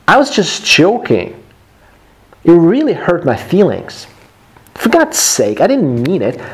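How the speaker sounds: animated quarrel read for learners, wide pitch swings; expressive textbook dialogue with lively intonation; acted dialogue reading. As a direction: act out both sides of a tiff.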